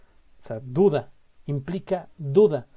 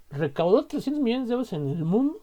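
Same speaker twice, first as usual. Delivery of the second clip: a touch of amazement, pitched higher; astonished; 6 semitones higher than usual. Shown as a demonstration. Reacting in amazement to a movie's box office. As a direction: read the figure as if you can't believe it. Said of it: "Yo digo, ah, o sea".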